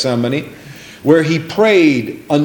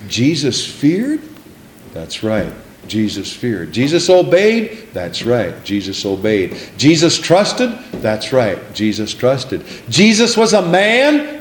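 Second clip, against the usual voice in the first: preacher quizzing then confirming; call and answer; 4 semitones lower than usual. Shown as a demonstration. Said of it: "call and response questions".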